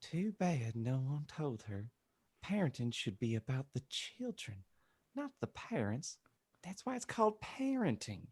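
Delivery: soft, gently disapproving reverend; mildly mournful character voice; sad Dumbledore. Acted. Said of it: "Dumbledore shook his head sadly".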